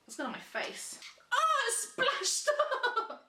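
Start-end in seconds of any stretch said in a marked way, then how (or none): 1.3-3.2 shocked shriek. Real, not acted. Avocado splashes her face.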